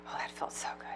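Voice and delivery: a soft whispered sigh of satisfaction. A narrator's reading. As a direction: whisper it with relief. After slamming down the phone.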